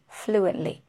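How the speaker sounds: normal talking voice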